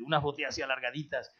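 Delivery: ordinary talk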